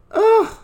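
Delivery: loud high "ugh" of disgust; exasperated groan. Real, not acted. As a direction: groan it out, fed up. Exasperated at the news.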